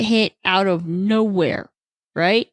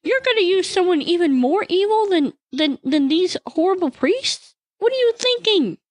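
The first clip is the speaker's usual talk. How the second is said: much higher, incredulous outrage; enraged disbelief; 7 semitones higher than usual. Retold or imitated. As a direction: cry out in disbelief at God. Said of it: "enrages Habakkuk".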